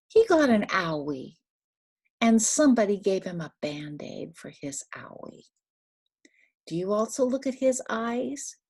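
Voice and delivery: ordinary delivery, nothing marked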